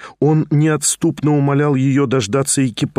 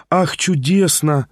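usual speaking voice

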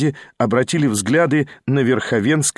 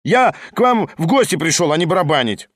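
higher, indignant refusal; agitated refusal; 6 semitones higher than usual. A narrator's reading. Lyamshin, a guest, refuses to keep playing, agitated refusal. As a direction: refuse as if leaping up from the piano. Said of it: "вскочил Лямшин".

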